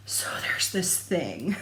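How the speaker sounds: slow guilty whisper; sheepish whisper. Retold or imitated. Admitting the pattern to her husband, sheepish whisper. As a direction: confess it in a small whisper. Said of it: "And I was like".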